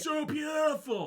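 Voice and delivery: drawn-out, high mock swoon. Imitating actor's overdone anime line, mock swooning. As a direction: stretch it high, exaggerated anime dub acting.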